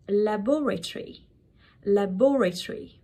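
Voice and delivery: "laboratory" as leh-BOR-uh-tree; British pronunciation. Shown as a demonstration. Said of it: "British English that might be possibly pronouncing wrong".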